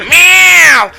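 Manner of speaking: cat yowl. Retold or imitated. Cat answers back in story.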